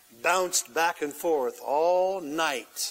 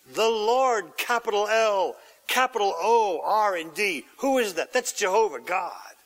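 usual speaking voice

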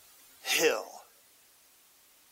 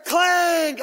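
metal clang; 10 semitones higher, 6 dB louder than usual. Acted. Hammer blows on the nails.

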